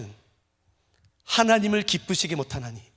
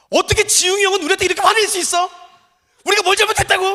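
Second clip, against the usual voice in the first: loud, high, aggrieved protest; indignant complaint; 12 semitones higher, 8 dB louder, faster than usual. Retold or imitated. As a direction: act out the young staffer's outrage. Upset colleague quoted.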